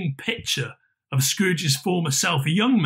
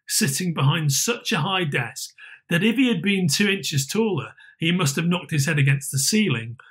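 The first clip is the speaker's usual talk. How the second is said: usual speaking voice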